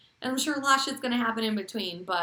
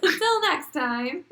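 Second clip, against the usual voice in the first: high singsong echo; 9 semitones higher, 4 dB louder, slower than usual. Real, not acted. Echoing the sign-off, higher.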